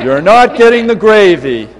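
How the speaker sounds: very loud mock outrage; worked-up refusal. Guests deny the old man gravy, loudly worked up. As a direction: firmly forbid it at full volume. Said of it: "everybody's getting all worked up".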